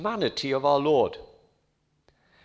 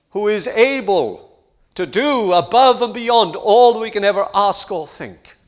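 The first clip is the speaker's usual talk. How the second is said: rising, louder gospel appeal; forceful preaching; 3 semitones higher, 6 dB louder than usual. Acted. Preacher urging the congregation to trust the Savior, loud and fervent. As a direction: press the call home to the crowd.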